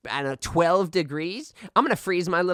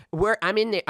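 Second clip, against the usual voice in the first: usual speaking voice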